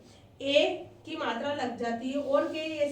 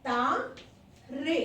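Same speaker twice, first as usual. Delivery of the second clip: तारे split into two drawn-out syllables, ता and रे; slow syllable-by-syllable; much slower than usual. Shown as a demonstration. Teacher writing out the plural for pupils, तारे sounded out slowly in syllables. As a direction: stretch तारे slowly, pausing between ता and रे.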